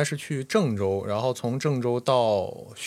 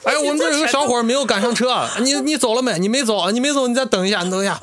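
mock urgent phone call; 10 semitones higher than usual. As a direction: fake panic into the phone. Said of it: "真的特别焦急". (模仿骗子焦急地打电话)

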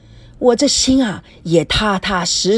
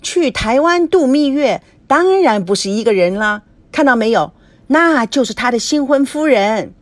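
gossipy butting in; 5 semitones higher, 3 dB louder than usual. A narrator's reading. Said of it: "高培志三八地抢着说".

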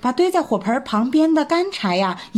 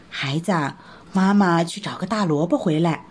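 a lightly lower, gentle mother rabbit; lightly voiced mother rabbit telling her child; 6 semitones lower than usual.

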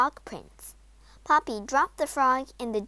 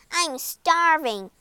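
lightly voiced frog, drawn out and louder; hungry frog; 6 semitones higher, 5 dB louder, slower than usual. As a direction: announce hunger slowly, pitch raised. Hungry frog demands food.

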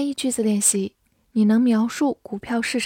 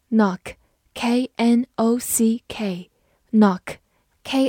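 slow spelling; much slower than usual. Shown as a demonstration. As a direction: spell out K N O C K letter by letter, very slowly. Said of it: "N只发前鼻音".

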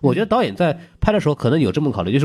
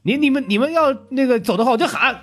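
high-pitched character quote; 13 semitones higher than usual. Retold or imitated. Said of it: "就是严素汐说".

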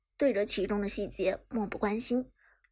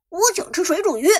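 excited; 7 semitones higher, 8 dB louder than usual. Acted. Eager kid-like food request.

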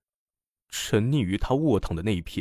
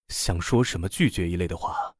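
plain reading voice

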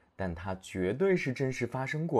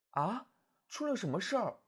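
higher, softer wife voice, puzzled; lightly voiced puzzled wife; confused wife; 6 semitones higher, 4 dB quieter than usual. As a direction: ask as a bewildered wife.

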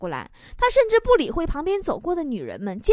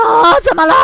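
loud, high startled cry; stunned alarm; 6 semitones higher, 12 dB louder than usual. Acted. Adult stunned by a child's pregnancy question, loud startled yelp. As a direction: blurt it out in loud mock shock. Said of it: "我当时都给我吓懵了".

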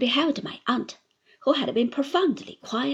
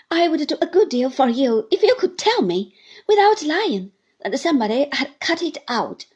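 high, loud sneer; mock him with spite; jeering Uriah; 4 semitones higher, 6 dB louder than usual. Uriah jeers at Copperfield, high and loud.

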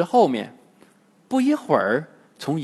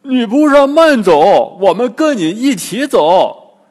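loud call; 7 semitones higher, 11 dB louder than usual. A narrator's reading. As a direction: shout after her so she waits. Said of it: "大声对姑娘喊". (高声大喊)

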